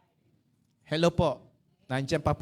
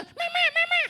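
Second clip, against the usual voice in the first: very high squeak like a duck with its neck squashed; comically tiny, high-pitched call; squeezed duck voice; 4 dB louder than usual. Shown as a demonstration. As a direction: squeal it like a little dwarf. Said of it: "parang itik na pinipitpit yung leeg".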